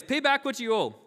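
demanding. A narrator's reading. Servant confronts his debtor, loud acted demand. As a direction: read it as a forceful order. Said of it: "he demanded".

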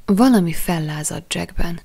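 plain reading voice

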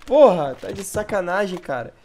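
loud, high furious yelling; raging gamer. Real, not acted. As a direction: scream at the screen in rage. Raging over lost round.

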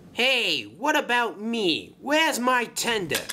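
high-pitched, put-out whine; complaining Vinny. Acted. Neglected engine asking after his missing tender, complaining. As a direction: pipe up high, feeling overlooked.

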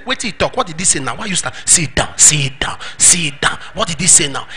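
low, soft asides from a puzzled congregation; hushed imitation of a disapproving pew neighbour; quiet mock grumbling. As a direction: grumble under the preacher at those standing. Acts grumbling unexcited church members.

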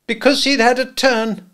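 high, loud, sharp woman's voice; scolding sister. A narrator's reading. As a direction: snap out the reason, high and loud. Sister's voice quoted in narration.

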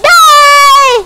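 high, loud yell; high furious yell; battle shout. Pretend. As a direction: scream it as the final blow. Final shot at zombie.

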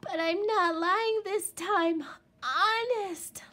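high, loud earnest plea; pleading. A narrator's reading. Little Wolf to his doubting parents, loud plea. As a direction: beg them to believe him. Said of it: "Little Wolf pleaded".

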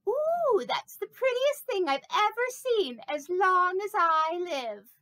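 very high gasping ooh of wonder; awestruck admiration. Pretend. People gaze at bronze snake, high awestruck gush. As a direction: gush like you've seen a treasure. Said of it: "everybody who looks at it says".

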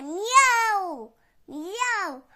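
high, loud meowing; loud cat meow. Acted. Cat spots meat on the table and meows loudly. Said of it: "та й каже".

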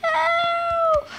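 drawn-out high cry for help. Acted. Engine cries out again.

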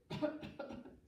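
small dry mock cough; cricket's cough. A narrator's reading. Teacher coughing for the cricket in the story. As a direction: fake a little cricket cough. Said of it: "coughed a cricket".

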